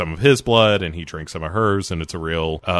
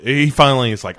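usual speaking voice

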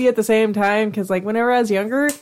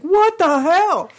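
slow, high, startled what the hell; drawn-out shocked reaction; 9 semitones higher, much slower than usual. Retold or imitated. Imagined reaction to shape.

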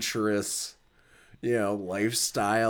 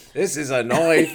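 louder, higher mock-Australian boast; Crocodile Dundee; 4 semitones higher, 7 dB louder than usual. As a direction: deliver the famous line in a hammed-up Aussie accent. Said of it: "with Crocodile Dundee".

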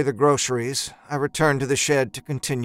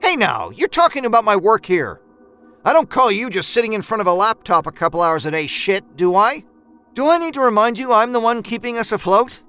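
higher and louder, heated; angry argument; 6 semitones higher, 4 dB louder than usual. A narrator's reading. Husband and wife quarrelling over money, heated and loud. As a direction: defend yourself hotly.

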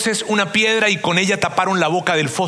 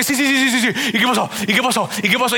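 put-on eager kids; 4 semitones higher than usual. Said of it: "todos estábamos como que".

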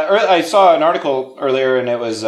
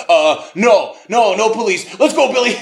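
higher, hurried backing out; hasty dodge; 8 semitones higher, 4 dB louder than usual. Retold or imitated. Grandpa escaping the post office with the boy, hasty dodge. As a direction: brush it off and flee. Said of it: "it's like".

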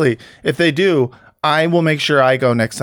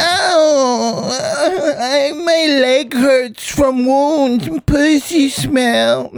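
high, slow mock whimpering Jude Law; Jude Law impression, crying; 12 semitones higher, much slower than usual. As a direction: whine it through fake tears. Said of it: "see, I can do it now".

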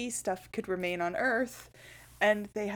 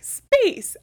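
dramatic exclamation; 13 semitones higher, 10 dB louder than usual. Retold or imitated. Retells show's big space reveal.

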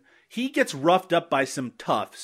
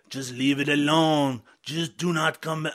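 put-on slow, accidental Quebec French accent; half-hearted Quebec French accent, slowly; 3 dB quieter, slower than usual. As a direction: slip into a mock Quebec French accent, drawn out. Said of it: "I'm doing Quebec French now".

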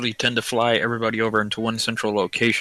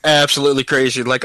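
ordinary delivery

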